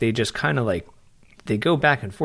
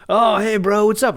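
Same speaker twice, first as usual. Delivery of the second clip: higher, louder buddy greeting; other-guy voice; 8 semitones higher, 4 dB louder than usual. Retold or imitated. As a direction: suddenly switch into an imitated bro on the phone. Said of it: "where did this other guy come from?".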